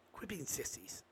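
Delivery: "quit being sissies" dropped to a hushed whisper. Real, not acted. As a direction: whisper "sissies" as if sharing a secret. Repeats the line, then whispers it.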